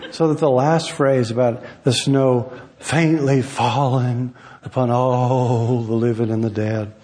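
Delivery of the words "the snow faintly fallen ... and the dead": slow amateur TV actor Southern drawl; drawn-out, mock-tragic Southern reading; bad Southern drawl. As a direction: recite it in a hammed-up Southern accent. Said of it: "in my amateur T V actor drawl".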